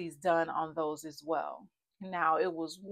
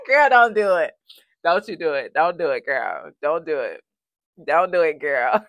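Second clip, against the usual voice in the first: louder, firm pep talk to herself; self-talk against tears; 11 dB louder than usual. Real, not acted. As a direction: hold back the tears, talk yourself down. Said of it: "I get emotional".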